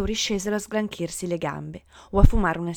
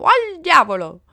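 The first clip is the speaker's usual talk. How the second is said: high, full-voiced yell; gleeful shout; 8 semitones higher, 10 dB louder, slower than usual. A narrator's reading. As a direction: yell it as you kick the ball.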